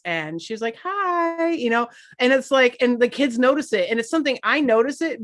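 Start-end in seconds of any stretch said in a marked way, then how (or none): 0.8-1.5 high sweet phone hi; chirpy client-call greeting; customer service voice. Retold or imitated. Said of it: "we make fun of Carrie, who she has to call clients".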